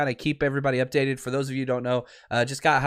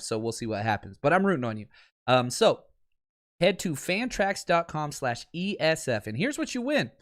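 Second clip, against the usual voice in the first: softer promo read for a sponsor; 5 dB quieter than usual. Acted. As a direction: pitch the free contest to listeners. Reads a sponsored ad.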